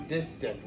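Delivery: distant with the middle t soft like a D; casual distant, dissdant, quietly. Shown as a demonstration. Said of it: "when people talk like that".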